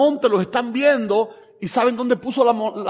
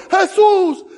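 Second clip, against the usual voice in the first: beggar crying out; 6 semitones higher, 3 dB louder, slower than usual. Acted. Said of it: "yo me lo imagino".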